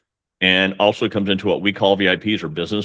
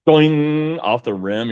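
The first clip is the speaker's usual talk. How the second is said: mock boing; 7 semitones higher, 3 dB louder, much slower than usual. Mimicking a ball rattling out.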